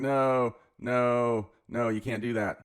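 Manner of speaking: hammed-up alarm at the bet; imitating panicked objection; mock protest. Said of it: "So they're like".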